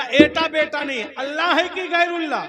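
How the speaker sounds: high, very loud demand with a laugh; furious shout. Acted. Sunni yelling at the cleric, high and loud. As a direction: bellow the question.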